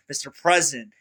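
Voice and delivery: ordinary talk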